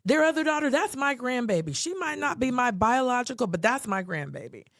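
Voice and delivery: high, proud and insistent; proud declaration. Retold or imitated. Voicing Starla's declaration.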